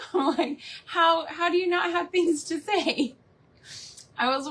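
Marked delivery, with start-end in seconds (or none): 0.9-3.1 higher-pitched mock disbelief; baffled, pitched-up question; incredulous. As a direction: ask it as if truly baffled. Mock disbelief at quiet husband.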